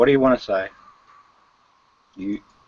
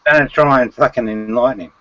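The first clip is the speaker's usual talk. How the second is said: ordinary talk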